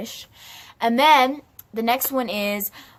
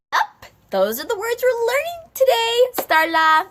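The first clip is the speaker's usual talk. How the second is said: high, strict teacher voice; stern teacher; 6 dB louder than usual. Acted. Teacher correcting Starla in class, high strict voice. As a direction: put Starla in her place.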